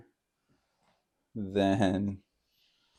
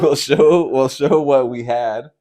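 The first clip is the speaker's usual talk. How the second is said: raised voice, pushing on; loud and insistent; 6 semitones higher, 13 dB louder, slower than usual. Real, not acted. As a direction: talk over her to finish the line. Insisting over partner.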